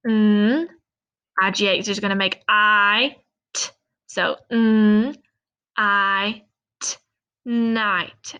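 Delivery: "Mm" "I" "t" split into separate sounds, then "night"; sounding out night. Shown as a demonstration. Teacher sounding out night slowly for students. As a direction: sound out each part, then blend. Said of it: "Let's look at this word".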